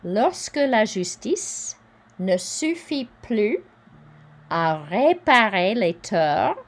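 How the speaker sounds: teacher reading French aloud; careful French reading. Shown as a demonstration. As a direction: read the quote as a model for learners. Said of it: "I'll read that part again".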